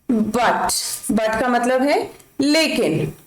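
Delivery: usual speaking voice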